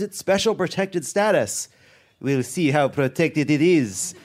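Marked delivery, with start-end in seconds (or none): 2.2-4.1 put-on slow, lazy French accent. Jean Valjean guarding the baguette, in a French accent. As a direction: drawl it out in a mock French accent. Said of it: "please do French accent".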